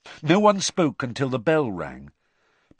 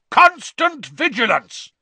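a huge, slow, high roar; Moody roaring at the startled class; 9 dB louder, slower than usual. Acted. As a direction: bellow it at the whole class. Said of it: "he roared".

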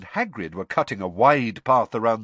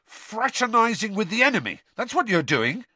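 high, heated charge; outraged, raised pitch; indignant accusation; 12 semitones higher than usual. Acted. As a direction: accuse a friend of betrayal. Ron accuses Hermione of betrayal.

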